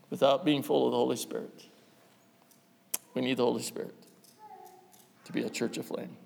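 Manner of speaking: dropping to a hushed, unvoiced close; quiet. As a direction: sink the voice almost to a whisper. Sermon point fading low.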